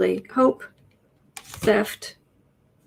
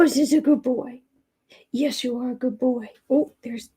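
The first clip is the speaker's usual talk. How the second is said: pet cooing; 3 semitones higher than usual. Real, not acted. Owner coos baby talk at her good boy. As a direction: coo at the kitty.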